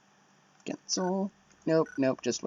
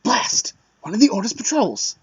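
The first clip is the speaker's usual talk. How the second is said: shouted, higher alarmed cry; alarmed soldier; 7 semitones higher, 6 dB louder than usual. Acted. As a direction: sound the alarm like a startled soldier. Voices alarmed character line.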